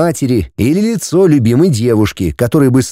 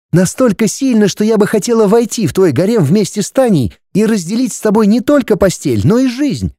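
higher, passionate female voice; young woman's voice; 8 semitones higher than usual. Acted. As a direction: voice the troglodyte girl passionately. Ulrika speaks passionately.

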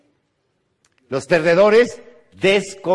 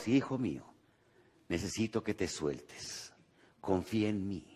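low, soft voice from above; voice of God; 6 semitones lower, 14 dB quieter than usual. Acted. God answering the man on the cliff, low and soft. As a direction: speak low and quiet like a calm God. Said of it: "se oyó una voz que dijo".

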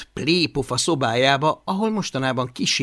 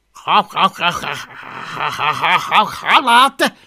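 stuttering build to a high, loud burst; stubborn dragon; 4 semitones higher, 6 dB louder than usual. A narrator's reading. Hungry dragon insists on fish, stubbornly stammering.

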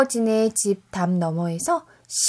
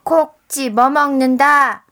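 loud slow call; 4 semitones higher, 7 dB louder, slower than usual. Acted. Grandma echoes loudly.

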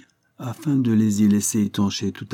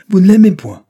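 much higher and louder, incredulous; outraged repeat; 11 semitones higher, 15 dB louder than usual. A narrator's reading. Judge repeating his daughter's words, outraged shout. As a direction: throw her words back at her. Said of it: "répéta monsieur R".

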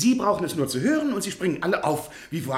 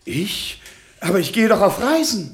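startled protest; 5 semitones higher, 7 dB louder than usual. Acted. Professor protests being made president.

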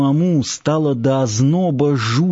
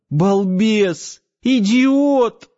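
sobbing; 9 semitones higher, much slower than usual. A narrator's reading. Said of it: "пуще прежнего зарыдала Надя".

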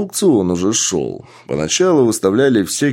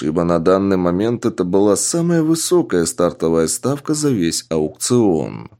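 usual speaking voice

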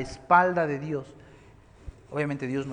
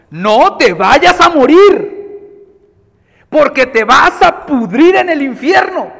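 slow, much higher, much louder preaching shout; fiery warning; 10 semitones higher, 17 dB louder, slower than usual. Retold or imitated. Pastor warns the young mechanic of hell, shouting. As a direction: thunder the warning of hell slowly.

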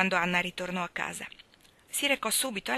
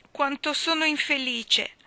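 high, slow lament; woeful child; 8 semitones higher, 4 dB louder than usual. A narrator's reading. Davy confessing misery to Anna, woeful child. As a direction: draw it out like a miserable small boy.

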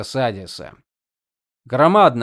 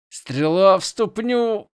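slow, forced through gritted teeth; 8 semitones higher, 3 dB louder, slower than usual. Acted. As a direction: grit your teeth and spit it out slowly. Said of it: "процедил Шрам сквозь стиснутые зубы".

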